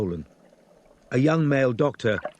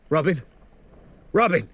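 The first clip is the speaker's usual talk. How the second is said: calling out; 5 semitones higher, 3 dB louder than usual. Acted. Calling her name on phone.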